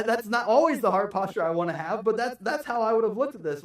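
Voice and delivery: usual speaking voice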